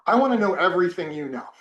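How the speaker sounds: laughing through an earnest plea; half-laughing at his own young boldness; eager request broken by a laugh. Retold or imitated. Eager student laughing at himself.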